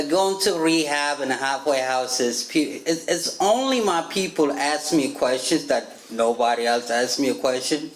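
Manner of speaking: usual speaking voice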